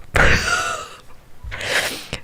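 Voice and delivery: high squeak of stifled laughter; helpless laugh. Real, not acted. Player reacting to a ridiculous suitor, helpless laughing. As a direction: burst out laughing, trying to stay hushed.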